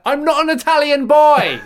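very high, loud acted protest; hammed-up shouted denial; exasperated shout. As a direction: yell it indignantly, re-enacting the outburst. Acts out exasperated denial to man.

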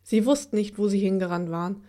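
ordinary delivery

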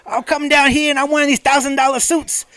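high, loud wrestling promo shout; rising promo yell naming cities; Ric Flair. Retold or imitated. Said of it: "So he'd be like".

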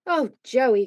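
plain reading voice

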